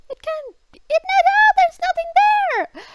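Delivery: very high squeaky brush-off voice; mock dismissive friend. Mocking how friend Kirti brushed off the scare, high squeaky mimicry. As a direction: mimic her shrugging it all off in a shrill mock tone.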